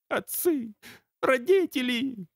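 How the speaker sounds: high wailing cry for his parents; high tearful wail; pained high wail. Acted.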